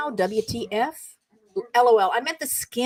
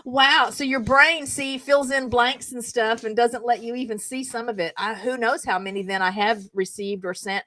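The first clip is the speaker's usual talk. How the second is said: ordinary delivery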